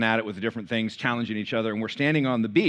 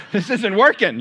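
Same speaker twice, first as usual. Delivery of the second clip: laughing; 9 semitones higher, 9 dB louder than usual. Real, not acted. Telling congregation he quit swimming, laughing. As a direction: laugh through the words. Laughing about giving up swim.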